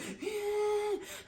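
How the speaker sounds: high, sharp gasp of pain. Retold or imitated. Mother recalling labour pain, a high sharp gasp. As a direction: gasp as the contraction hits. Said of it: "I was like".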